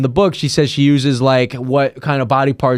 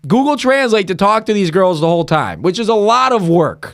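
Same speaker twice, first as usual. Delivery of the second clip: exasperated rant; 6 semitones higher than usual. Real, not acted. Exasperated complaint.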